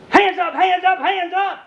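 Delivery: high barked command; uniformed officer at the car window, high shouted command; police shout. Retold or imitated. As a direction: bark it like a cop.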